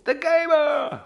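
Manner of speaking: high, loud cheer; excited greeting. Real, not acted. Hailing a viewer who joined early, in a high excited cheer.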